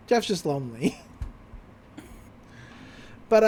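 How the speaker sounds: normal talking voice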